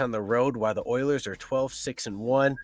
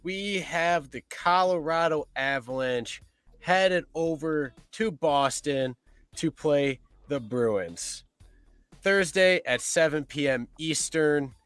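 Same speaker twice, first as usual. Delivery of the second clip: slow, high game announcer read; 3 semitones higher, slower than usual. Acted. Host reading the first game, slow announcer voice. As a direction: lay out the matchup deliberately.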